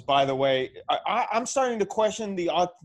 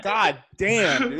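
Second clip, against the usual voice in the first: usual speaking voice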